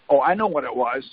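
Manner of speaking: ordinary talk, nothing marked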